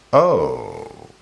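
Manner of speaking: "oh" drawn out, sliding down low; trailing ellipsis. Shown as a demonstration. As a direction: let "oh" carry on and sink. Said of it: "Sort of just carries it on".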